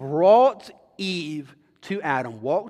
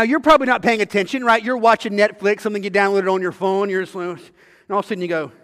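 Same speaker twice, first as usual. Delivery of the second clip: ordinary delivery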